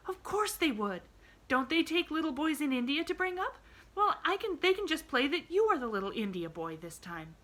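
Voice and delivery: put-on a bright little girl, gently arguing; a hint of an earnest young girl; Pollyanna. A narrator's reading. Pollyanna voiced in story.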